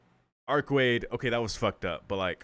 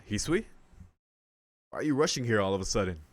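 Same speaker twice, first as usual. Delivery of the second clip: mildly surprised, quick question; faster than usual. A narrator's reading. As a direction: ask it with a touch of surprise. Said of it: "truly surprised".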